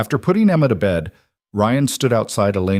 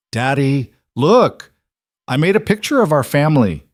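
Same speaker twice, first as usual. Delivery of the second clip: put-on excited little girl; 6 semitones higher, 4 dB louder than usual. A narrator's reading. Excited little girl showing drawing.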